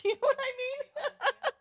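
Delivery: laughing through the words, high and slow. Real, not acted. Host asking fans, laughing question. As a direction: let the laugh carry the question.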